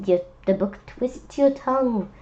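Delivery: ordinary delivery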